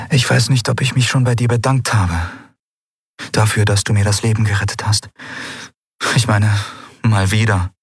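lightly voiced heroine, searching for words; Endriel, grateful. A narrator's reading.

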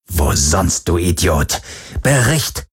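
scornful demand from the boss; contemptuous boss. Acted. Varidur fährt den Mann an. (verächtlicher Boss, tiefer)

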